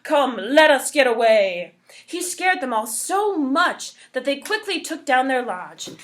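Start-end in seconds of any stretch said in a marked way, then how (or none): 0.0-1.7 loud urgent warning shout; shouting alarm. Acted. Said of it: "he shouted".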